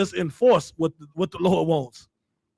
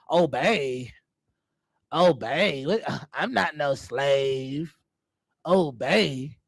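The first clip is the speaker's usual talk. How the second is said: mocking offended sisters; 5 semitones lower, much slower than usual. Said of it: "like a cuss word to some of you sisters".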